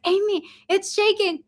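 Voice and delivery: high-pitched call to wake a sleeper; retold calling out. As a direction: call her urgently, without real volume. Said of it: "I had to call her three times".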